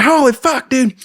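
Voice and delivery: loud, high mock yell; astonished yell. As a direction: imitate a dazed buddy yelling in amazement. Revived buddy reacting.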